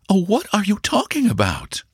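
put-on high, astonished young woman's voice; bewildered, caught off guard; startled exclamation. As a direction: stenographer startled by the broker's proposal, high and astonished. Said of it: "exclaimed the young lady".

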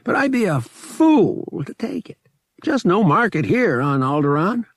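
regretful. Acted. Lyle turning down Han's spice, regretful. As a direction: let him down gently. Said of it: "sounding regretful".

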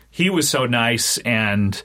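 ordinary talk, nothing marked

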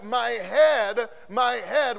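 loud, pained cry of my head; clutching his aching head, crying out; anguished cry. Retold or imitated. Boy in the field crying out in pain, loud anguish. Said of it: "And this boy said".

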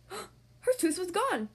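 shocked gasp. Acted. Tooth missing from the box.

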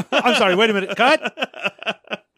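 laughing through a fake apology; laughing mock interruption. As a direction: giggle out a hammy cut. Mocking interrupting actor.

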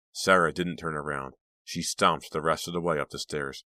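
ordinary delivery, nothing marked

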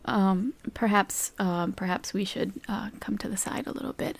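hushed, very soft aside; discreet murmur. Acted. Philippe asks his brother to come aside, hushed. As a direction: drop to a near whisper.

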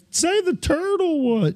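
high-pitched impression of a demanding audience; heckling crowd. Bingo players begging the caller for a favourite, high mock heckle. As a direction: call out a request in a high voice.

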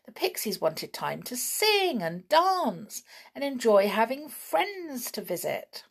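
ordinary delivery, nothing marked

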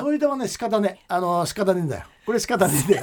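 broad Akita dialect, easygoing. Acted. ラジオで秋田弁を頼まれた放送作家の秋田弁. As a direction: let the Akita country speech roll out. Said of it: "秋田弁で話してくれって言ったから".

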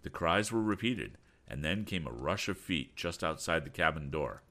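ordinary delivery

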